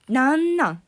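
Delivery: indignant what. Real, not acted. Fuming at the father's remark.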